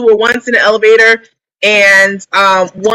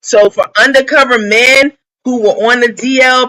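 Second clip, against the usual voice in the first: normal talking voice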